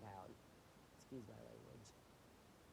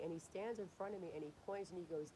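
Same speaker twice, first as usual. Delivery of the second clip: ordinary talk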